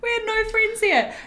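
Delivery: mock dramatic wail. Dramatic lament, high and loud.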